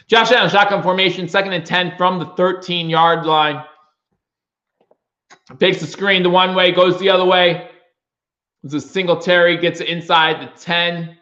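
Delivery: quick, pumped sportscaster call; play-by-play call. Acted. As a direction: narrate the drive like a broadcaster. Fan streamer calls the game.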